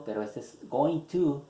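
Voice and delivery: ordinary talk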